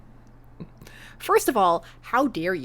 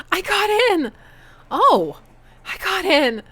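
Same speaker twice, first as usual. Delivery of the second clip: thrilled, higher and louder; excited; 10 semitones higher, 6 dB louder, slower than usual. Acted. Amanda reads her acceptance letter, overjoyed shout. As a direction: burst out with joy. Daughter accepted to art school.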